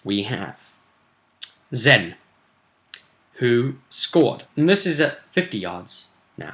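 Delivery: normal talking voice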